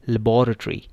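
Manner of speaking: लेबोरेटरी as la-BOR-a-tory, stress on bor; British pronunciation. Shown as a demonstration. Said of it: "इसकी दो pronunciations हैं". (लेबोरेटरी British उच्चारण, बॉ पर ज़ोर)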